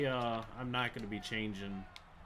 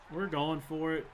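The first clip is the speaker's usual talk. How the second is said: ordinary talk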